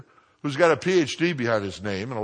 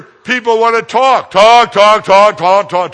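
booming, high, hammered repetition; loud mocking rant; 8 semitones higher, 14 dB louder, slower than usual. Shown as a demonstration. Preacher mocking people who only talk, loud rant. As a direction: pound it out louder on each repeat.

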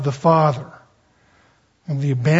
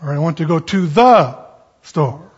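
THE punched loud, set apart before "store"; stress on THE; 4 dB louder than usual. Shown as a demonstration. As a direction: lean hard on "the", then pause. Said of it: "The emphasis in this".

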